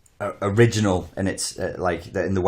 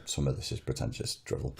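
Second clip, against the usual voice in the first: breathy muttered verdict under breath; whispered aside; 6 semitones lower, 11 dB quieter than usual. Retold or imitated. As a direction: whisper it like a private thought. Retold inner verdict on rewatch.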